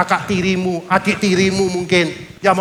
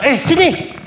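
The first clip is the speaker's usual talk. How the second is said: soldier's order; 5 semitones higher than usual. Acted. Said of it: "Ada tentara-tentara Romawi narik".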